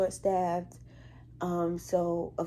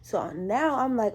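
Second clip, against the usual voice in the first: ordinary talk, nothing marked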